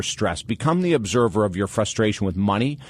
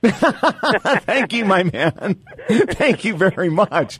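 chuckling through his thanks, pitched up; laughing thanks; 6 semitones higher, much slower than usual. Real, not acted. Thanking the guest for the compliment, laughing. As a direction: laugh it off, delighted.

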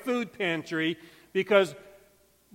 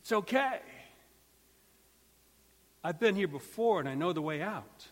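softer, steady reassuring voice; calm reassurance; 6 dB quieter than usual. Acted. Friend in the hole calmly reassures the trapped man, soft. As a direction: settle him down gently.

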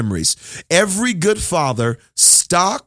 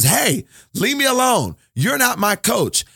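annoyed brother; 7 semitones higher than usual. Retold or imitated. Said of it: "Zion turns to Nyla and says".

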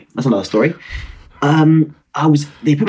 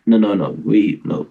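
put-on lower-pitched, makeshift French accent; weird French accent; 3 semitones lower than usual. Retelling the old pushers giving up, weird French accent. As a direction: refuse in a loose French accent. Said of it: "had a weird French accent there".

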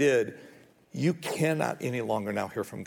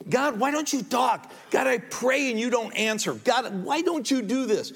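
high, pleading whine at God; mock frustrated pleading; frustrated prayer; 10 semitones higher than usual. Congregant's frustrated prayer voiced.

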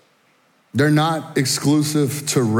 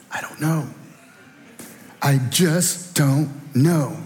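sheepish i-don't-know; slower than usual. Retold or imitated. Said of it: "To that we reply".

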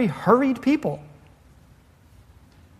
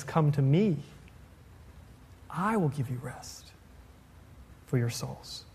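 hushed, slow and much softer; almost breathe the promise; soft reverent; 7 semitones lower, 14 dB quieter, slower than usual. Retold or imitated. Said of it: "Jesus says".

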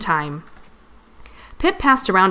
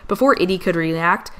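usual speaking voice

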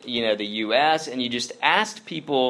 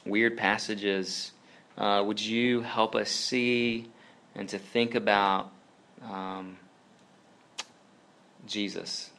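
opening prayer; 3 semitones lower, 3 dB quieter than usual. Real, not acted. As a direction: pray it softly and unhurried, pausing between phrases. Pastor prays before the sermon.

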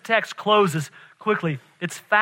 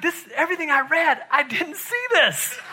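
mock alarm; 6 semitones higher than usual. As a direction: protest in hammed-up dismay. Acting out alarm at the plane door.

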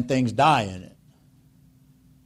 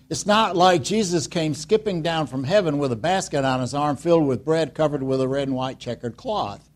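ordinary talk, nothing marked

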